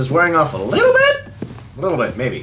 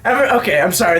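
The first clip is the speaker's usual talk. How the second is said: normal talking voice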